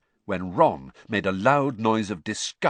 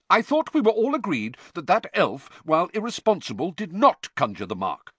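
heated protest, higher and louder; hot; 10 semitones higher, 5 dB louder than usual. A narrator's reading. Son heatedly arguing with his father at dinner. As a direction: defend the elf decision with heat. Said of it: "said Percy hotly".

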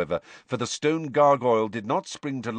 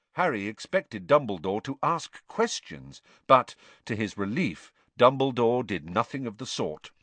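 usual speaking voice

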